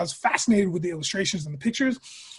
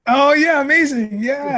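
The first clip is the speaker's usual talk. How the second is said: bright burst, higher and louder; excited; 7 semitones higher, 7 dB louder, slower than usual. Real, not acted. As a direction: lights up at the memory. Friend recalls fun video together.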